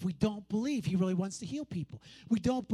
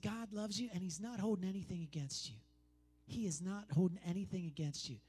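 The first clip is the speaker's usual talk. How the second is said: softer, low reassuring voice; gentle reassurance; 4 semitones lower, 8 dB quieter than usual. Retold or imitated. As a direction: comfort her quietly. Retelling comforting a lady.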